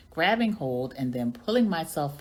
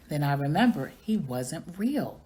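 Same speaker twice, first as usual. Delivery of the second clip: ordinary delivery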